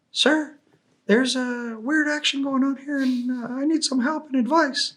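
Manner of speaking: put-on high, timid young paratrooper calling for help; nervous private asking his sergeant for advice; afraid young soldier. Young paratrooper on the radio to the team sergeant, high and timid. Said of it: "like he's afraid sounding".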